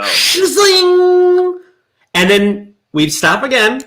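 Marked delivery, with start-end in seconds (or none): 0.0-1.6 spoon whoosh noise. Retold or imitated. Wooden spoon noise imitated.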